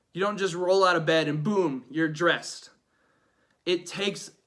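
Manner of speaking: normal talking voice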